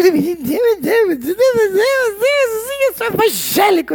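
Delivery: high, slow, wailing imitation of a pious complainer; drawn-out mock wail; whiny mock crente. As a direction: ham up a self-pitying believer moaning to God. Mocking a whiny believer.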